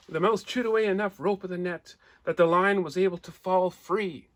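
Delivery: ordinary delivery, nothing marked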